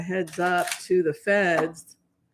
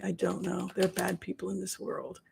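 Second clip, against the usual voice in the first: mock tearful reply; 10 dB quieter than usual. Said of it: "And he's just crying".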